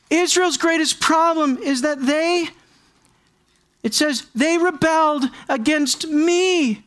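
high, loud, slow preacher cry; preaching at full force. Acted. Preacher crying out the main point to the congregation. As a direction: proclaim it with full weight.

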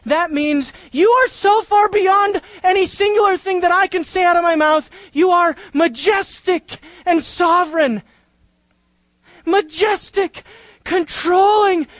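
rising cry of adoration; impassioned praise. Acted. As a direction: pour out praise to God. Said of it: "That's what he says".

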